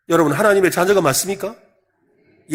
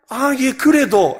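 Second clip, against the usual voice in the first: slow, sheepish but stubborn; shameless insisting; 5 semitones higher, 4 dB louder, much slower than usual. Acted. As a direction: drag it out as if unbothered. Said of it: "아, 뻔뻔하게". (뻔뻔하게 느릿느릿 버티는 말투)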